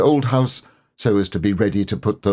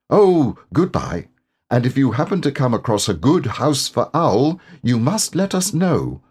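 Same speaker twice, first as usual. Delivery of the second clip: higher rabbit calling out; 6 semitones higher than usual. Acted. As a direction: call it back like a bustling rabbit.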